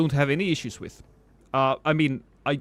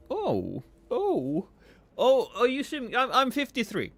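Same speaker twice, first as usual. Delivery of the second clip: higher, faux-indignant joke; mock offended; 8 semitones higher than usual. Streamer jokingly lying to chat about age, mock offended. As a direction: play wounded dignity, then lie about your age.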